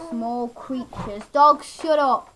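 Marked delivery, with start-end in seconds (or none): 1.3-2.3 sudden loud yell at the dogs; scolding shout. Real, not acted. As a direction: raise your voice sharply to hush barking dogs. Scolding noisy dogs during filming.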